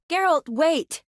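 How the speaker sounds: urgent, high call; calling after someone. Acted. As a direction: call after them to wait. Calling after Geralt as he leaves.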